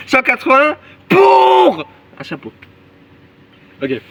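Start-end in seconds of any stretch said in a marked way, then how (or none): 0.1-2.5 much higher, louder and slow; slow, outraged shout at a silly price. Pretend. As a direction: yell the price in disbelief.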